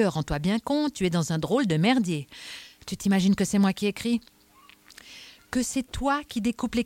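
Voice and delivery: mildly exasperated inspector; insistent Adamsberg. Acted. Inspector warns a possible suspect, insistent. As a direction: press him hard, point by point.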